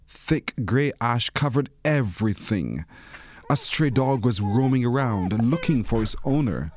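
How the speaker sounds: usual speaking voice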